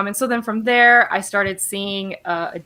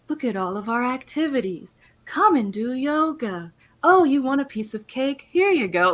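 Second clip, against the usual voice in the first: higher, sing-song mock sales pitch; welcoming hospital staff; 5 semitones higher than usual. Nonprofit hospital staff showing a new patient around, mock eager welcome. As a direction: imitate over-eager hosts offering treats. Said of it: "they were like".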